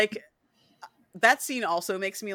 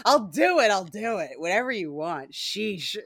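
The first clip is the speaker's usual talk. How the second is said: reluctant giving in. Retold or imitated. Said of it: "other vampires can't help but go".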